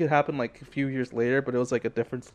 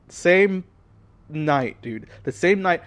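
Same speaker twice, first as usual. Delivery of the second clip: high, loud stress on same; can you believe it; excited emphasis on the same night; emphatic disbelief; 4 semitones higher, 6 dB louder, much slower than usual. Real, not acted. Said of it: "emphatic retelling".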